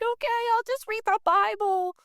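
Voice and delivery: very high, whiny concession; high-pitched retold reluctant okay; mock giving in. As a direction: give in with a squeaky, put-on whine. Her grudging giving in, acted out.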